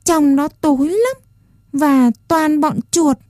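put-on a small boy holding back tears; near tears. A narrator's reading. Puppet boy begs not to return to the dark shed, near tears. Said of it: "nói như sắp khóc".

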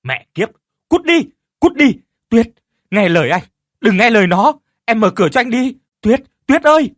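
very loud, high frantic shouting; desperate screaming. Acted. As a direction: scream at the demon and beg her. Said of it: "hét lên trong sự vô vọng". (hét lên vô vọng)

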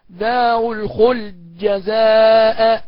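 very slow chanted دار الخلد جزاء with the د merged into the ج; full merging. Shown as a demonstration. Teacher reciting the full-merge reading. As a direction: draw it out, swallowing the dal. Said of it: "يقرأ بالإدغام المحض هكذا".

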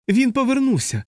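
high shrill screaming, panicked; hysterical shriek. A narrator's reading. Dunbar shrieks to the ward in hysterical terror. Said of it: "істерично заверещав Данбар".

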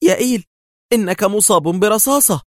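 frightened outcry; alarmed scream. A narrator's reading. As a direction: cry out seeing his wound. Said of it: "قبل أن تصرخ".

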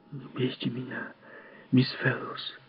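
put-on frightened child whispering. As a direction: breathe it out, scared. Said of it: "прошептал он". (испуганный шёпот)

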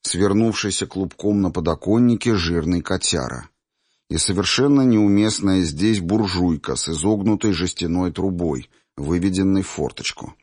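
plain reading voice

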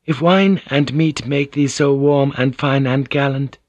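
Mariko, playful. Acted. Narrator voices Mariko in dialogue.

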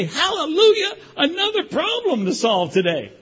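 high laughing hammed-up praise; mock joyful hallelujah. Pastor mocking a cheerful believer, high laughing hallelujah. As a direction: play the over-eager believer, laughing.

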